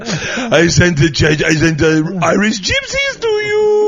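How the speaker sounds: high, slow, loud goofy vampire voice; Adam Sandler vampire. Pretend. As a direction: ham up a silly vampire accent. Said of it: "Adam Sandler vampire".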